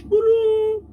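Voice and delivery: loud high cry of Guru; loud exclamation; shout. Real, not acted.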